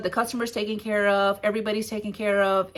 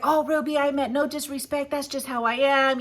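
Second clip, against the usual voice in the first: apologetic protest; 5 semitones higher than usual. Retold or imitated. Retold broker apologising after being scolded.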